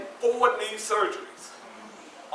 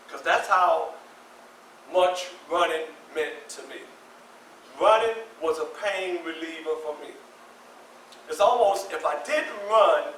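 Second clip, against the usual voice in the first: ordinary talk, nothing marked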